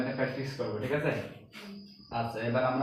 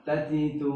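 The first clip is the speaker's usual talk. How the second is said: "তাতিতু" as three short, even syllables; no stretching; 3 semitones higher, much slower than usual. Shown as a demonstration. Teacher models harakat for pupils, "তাতিতু" without drawn vowels. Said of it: "হরকত টান হবে না".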